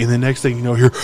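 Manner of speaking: normal talking voice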